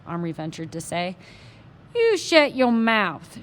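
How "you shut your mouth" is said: slow, loud Texas snap; louder, slow angry Texas cowboy; angry shout. Acted. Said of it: "shouted the little man".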